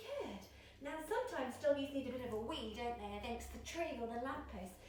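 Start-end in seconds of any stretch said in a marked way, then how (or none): none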